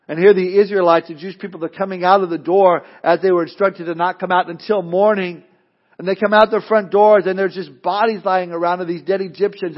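usual speaking voice

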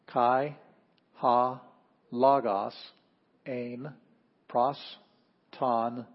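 slow word-by-word Greek recitation, each word set apart; reading Greek aloud. Shown as a demonstration. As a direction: read the Greek text aloud with the class, one word at a time. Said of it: "Can we read it together instead of pronouncing after me".

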